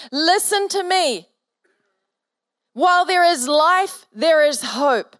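a pastor urging a frightened friend, fervent; impassioned urging. Real, not acted. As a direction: pour your faith into her.

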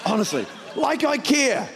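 high, slow comic exasperation; mock-indignant plea, dragging out the words; hammed-up fed-up passenger; exasperated rant. Mock rant at the pilot continues.

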